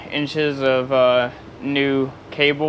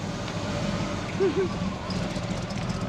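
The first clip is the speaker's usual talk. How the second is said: breathless laugh; 10 dB quieter than usual. Real, not acted. Laughing at the repair.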